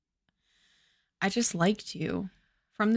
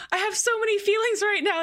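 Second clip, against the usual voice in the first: higher, fast, mock gushing; mock swoon over the scene; overwhelmed; 9 semitones higher, faster than usual. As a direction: blurt it like you're overcome. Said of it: "and I was like".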